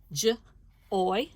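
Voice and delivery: J then oi with a pause; sound by sound. Shown as a demonstration. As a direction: segment into separate sounds. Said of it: "I'm going to say some words, sound by sound".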